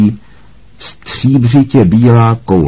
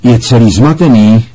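mild call-out to the class; Lupín exclaiming; 2 semitones higher, 4 dB louder than usual. A narrator's reading. Lupín to the class about the muddled boggart, exclaiming. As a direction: exclaim it, slightly raised. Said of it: "vykřikl Lupín".

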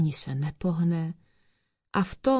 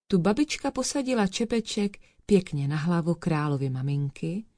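usual speaking voice

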